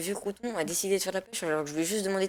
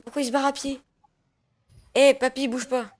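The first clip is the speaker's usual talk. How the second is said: higher, louder call to stop; shouting; 7 semitones higher, 5 dB louder than usual. Acted. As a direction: yell at grandpa to freeze. Shouting at the fleeing old man.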